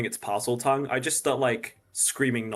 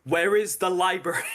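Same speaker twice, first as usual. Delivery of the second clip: higher, louder shouted gibberish; screaming nonsense; 8 semitones higher, 5 dB louder than usual. Pretend. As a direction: scream it at the bird. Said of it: "I scream at it in Abyssal".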